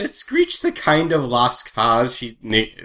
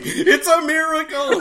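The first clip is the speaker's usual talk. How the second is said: high, slow, laughing "it's a miracle"; amazed exclamation; 4 dB louder than usual. Retold or imitated. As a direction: gasp it out in delighted disbelief. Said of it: "and I'm like".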